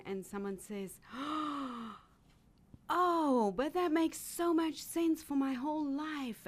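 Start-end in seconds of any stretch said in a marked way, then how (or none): 1.1-1.9 sudden gasp. Retold or imitated. Client's moment of realization retold. 2.9-6.4 higher and louder, an eager burst of discovery; amazed realization. Retold or imitated. Client's realization quoted by coach.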